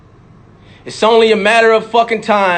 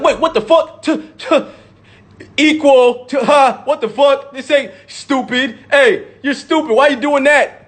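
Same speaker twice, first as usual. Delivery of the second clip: higher-pitched scoffing disbelief; mock triggered objector; 4 semitones higher than usual. Speaker mimicking someone against people fighting for rights, mock indignant. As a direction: imitate an indignant heckler, pitched up. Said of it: "I would never be like".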